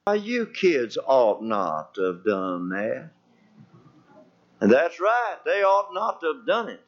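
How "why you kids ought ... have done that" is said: low, slow imitation of a grandpa; mock a feeble scolding, drawn out and low; slow, deep-voiced mock reproach; grandpa's mild scolding. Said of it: "you know what that fella said".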